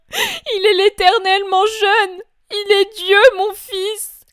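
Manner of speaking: a mother refusing to believe her son is gone; heightened voice of the grieving mother; grieving Mary. Acted. Mary pleading with both men.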